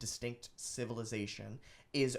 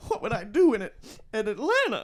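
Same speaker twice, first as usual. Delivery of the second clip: high, loud melodramatic Southern belle; put-on British-doing-Southern lady accent; transatlantic lady; 9 semitones higher, 9 dB louder than usual. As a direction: wail it like a hammed-up film heroine. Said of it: "like, transatlantic lady accent".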